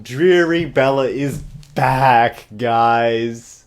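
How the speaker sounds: slow sarcastic declaration; sarcastic announcement. Real, not acted. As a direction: announce the gloom with irony.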